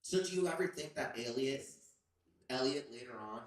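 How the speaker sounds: ordinary talk, nothing marked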